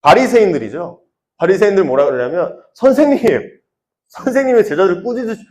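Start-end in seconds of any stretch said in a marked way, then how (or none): 2.7-5.4 high-pitched acted complaint; Pharisees' protest. Pharisees object to Jesus about disciples, protesting. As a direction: appeal insistently to the teacher.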